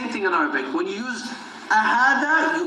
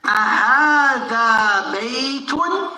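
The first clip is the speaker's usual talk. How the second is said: slow questioning; 2 semitones higher, much slower than usual. Shown as a demonstration. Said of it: "He's asking".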